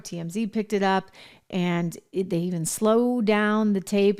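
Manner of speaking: ordinary talk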